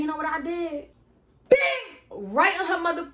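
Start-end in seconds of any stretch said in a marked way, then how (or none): 1.5-1.9 sharp high shouted "bing"; punch sound. Retold or imitated. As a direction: smack the sound out. Imitating the punch landing.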